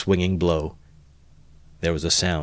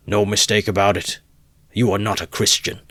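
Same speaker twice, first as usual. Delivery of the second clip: condemning shout; 3 semitones higher, 4 dB louder than usual. A narrator's reading. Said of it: "many voices were shouting".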